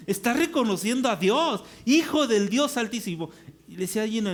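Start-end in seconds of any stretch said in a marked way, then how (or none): 1.8-3.3 loud, raised-pitch astonishment; amazed proclamation. Real, not acted. Preacher marvels that a demon recognizes God, amazed and loud.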